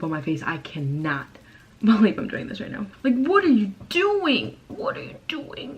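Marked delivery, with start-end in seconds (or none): 3.0-4.6 high, loud and slow, mock-scolding herself; a playful loud mock scold at her own reflection; mock exclamation. 4.7-5.8 high, slow unvoiced whisper; a playful secret whisper to herself; mock whisper.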